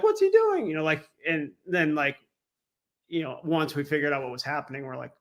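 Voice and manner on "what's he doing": high, incredulous squeak of a question; baffled disbelief. Retold or imitated. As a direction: pitch it way up in confusion. Baffled band watching Dan.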